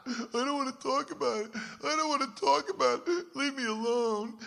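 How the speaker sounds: high, far younger voice, refusing to talk; upset little boy. Retold or imitated. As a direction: play his tearful eleven-year-old self pushing away help. Crying boy pushing Jimmy away.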